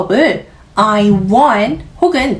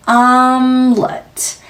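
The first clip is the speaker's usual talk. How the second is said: "omelette" drawn out slowly, humming the m; slow "omelette" down and let the m resonate; slow nasal omelette; much slower than usual. Shown as a demonstration. Teacher modelling the nasal ring in "omelette". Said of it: "이 콧소리 때문에 그 울림을".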